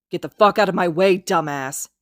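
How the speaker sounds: loud angry snap. Acted. Kacchan shoving past Izuku, snapping loudly. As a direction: bite the words off at him. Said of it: "Kacchan snaps".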